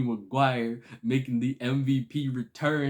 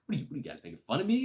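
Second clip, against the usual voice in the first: fast defensive; 6 semitones higher, much faster than usual. Retold or imitated. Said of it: "And he's just like".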